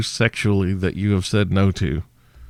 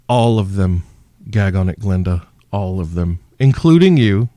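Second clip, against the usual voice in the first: ordinary talk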